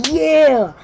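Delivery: a sudden high, loud "yeah!"; excited yell. Retold or imitated. Gamer whooping as the new game starts, excited yell.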